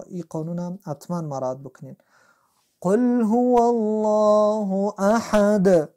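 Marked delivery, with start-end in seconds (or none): 2.8-5.9 slow chanted قل هو الله احد with a full, heavy الله; slow recitation. Shown as a demonstration. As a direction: recite loudly and drawn out. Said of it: "الله رنگش سبز شده".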